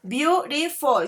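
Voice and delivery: "Beautiful" slowly divided into three beats; syllable by syllable. Shown as a demonstration. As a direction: sound out each syllable of "Beautiful". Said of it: "the amount of a voice that you use".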